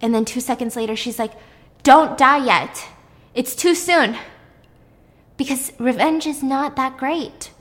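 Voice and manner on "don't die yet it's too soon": loud, drawn-out, higher retold plea; mad protest. Host voices the avenger robbed of her revenge, mad. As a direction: act out her frustrated demand, stretching the words. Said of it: "And she's mad".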